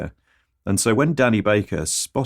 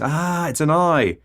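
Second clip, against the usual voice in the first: mock surprise; 8 semitones higher, slower than usual. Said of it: "then goes".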